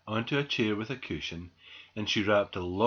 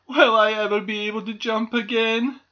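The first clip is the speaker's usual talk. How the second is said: high, loud, pleading question; anxious; 11 semitones higher, 8 dB louder than usual. A narrator's reading. Squirrel asks doctor about jumping, anxious. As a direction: ask fretfully in a small creature's voice. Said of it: "asked Squirrel anxiously".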